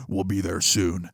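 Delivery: low, slow and soft mock menace; ominous driver imitation. Driver to locked-in passenger, low and slow mock menace. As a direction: drop low and draw it out ominously.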